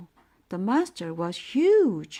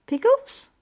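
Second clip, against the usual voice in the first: much higher and louder astonished "Pickles?"; shocked echo; 8 dB louder than usual. Acted. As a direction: squeak out "Pickles?" in disbelief. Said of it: "I said".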